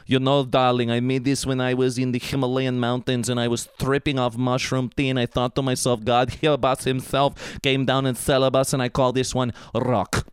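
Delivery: put-on affected art-girl voice; mock arty socialite, evenly intoned; pretentious artist. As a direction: play a self-important sculptor, darling.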